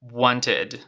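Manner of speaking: "wanted" carefully ending in ID; model pronunciation. Shown as a demonstration. Teacher has students repeat along, careful model. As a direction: give "wanted" as WON-tid to be echoed. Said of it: "Repite conmigo".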